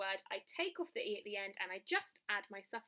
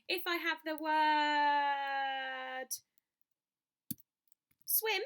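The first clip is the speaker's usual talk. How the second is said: drawn-out build-up; 3 semitones higher, 4 dB louder, much slower than usual. Acted. Teacher drawing out the next example.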